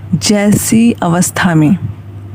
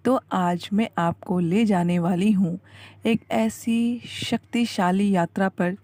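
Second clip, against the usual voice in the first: soft, measured guided-relaxation voice; hushed meditation intro; 12 dB quieter than usual. Acted. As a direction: gently draw a tired listener in, very softly. Opens guided meditation video with hushed teaser. (धीमी, शांत ध्यान वाली आवाज़)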